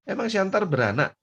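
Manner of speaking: very high incredulous question; challenging retort. Retold or imitated. Friend questioning whether a town gives birth, incredulous. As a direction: repeat it as high-pitched disbelief. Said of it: "Katanya gitu".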